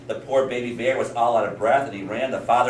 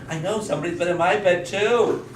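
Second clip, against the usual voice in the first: higher-pitched, lightly voiced storybook mother; mother in story; 8 semitones higher than usual. Mother finds her bed slept in, lightly acted complaint. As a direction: chime in with mild indignation. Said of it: "the mother said".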